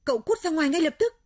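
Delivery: high, forceful shout; yelling at a friend, loud and high; loud angry yell. Acted. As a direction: bellow an order to get out. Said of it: "Cô bạn liền quát to".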